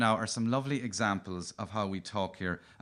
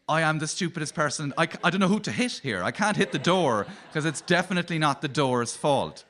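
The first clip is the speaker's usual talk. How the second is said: higher, quicker flustered rant at yourself; mock-furious inner monologue, pitched up; exasperated self-rant; 6 semitones higher, 4 dB louder than usual. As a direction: play someone furious with nobody to blame. Mock rant about self-inflicted pain.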